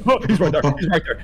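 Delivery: high loud alarmed cry; alarmed shout. Real, not acted. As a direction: shout the warning, panicked. Spots the monster, alarmed.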